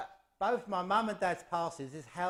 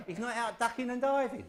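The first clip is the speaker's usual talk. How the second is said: laughing; 8 semitones higher than usual. Real, not acted. Jokes about dad's whereabouts.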